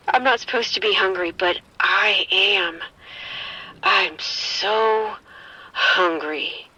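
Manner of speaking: slow, low, unsettling craving; eerie hunger. Acted. Infected woman confessing to the sergeant, eerily low. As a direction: let the hunger take over. Turning into a zombie.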